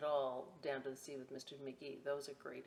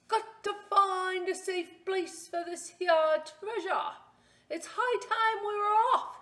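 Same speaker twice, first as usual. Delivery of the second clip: a captain ready to set sail; pirate announcing; 14 semitones higher, 11 dB louder than usual. Acted. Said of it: "he announced".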